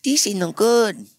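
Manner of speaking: put-on high slow sulky kid voice. Kid rejecting the beans, slow high sulk. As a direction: drag it out like a let-down child. Kids at bean piñata party.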